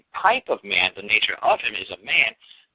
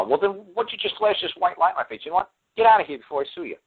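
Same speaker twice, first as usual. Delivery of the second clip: acted indignation; 8 semitones higher than usual. Acting indignant dismissal of pony god.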